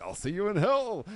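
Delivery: a touch of a defiant wrestler's promo; Cody's parting line. Retold or imitated. Cody Rhodes answering The Rock as he leaves, a mock defiant line. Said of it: "the Cody Rhodes like".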